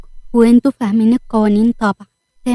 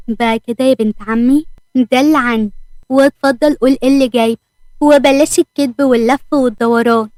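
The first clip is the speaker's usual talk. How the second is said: plain reading voice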